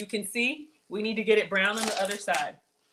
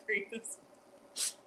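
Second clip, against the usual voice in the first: whispered laughing repeat; 9 dB quieter, much slower than usual. Shown as a demonstration.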